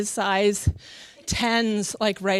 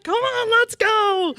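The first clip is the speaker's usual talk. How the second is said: high, drawn-out eager cheer; mock-eager husband urging everyone on; imitated excitement; 11 semitones higher, slower than usual. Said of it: "he was so friggin' excited".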